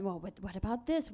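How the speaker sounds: ordinary talk, nothing marked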